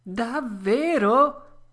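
high, loud, drawn-out joyful puppet cry. A narrator's reading. Pinocchio shouting with joy at the news. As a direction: leap up with joy on it. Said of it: "gridò Pinocchio, saltando dall'allegrezza".

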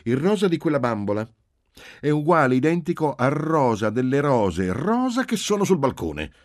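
put-on high, loud little-girl lecture; exasperated, high-pitched insistence; impatient know-it-all child. A narrator's reading. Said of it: "con impaziente saccenteria".

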